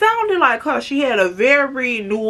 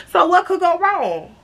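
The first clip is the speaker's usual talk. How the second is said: high, breezy mock innocence; naive rhetorical question; 6 semitones higher than usual. Storyteller sums up the fun night, mock-innocent. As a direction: ask it lightly in a raised voice.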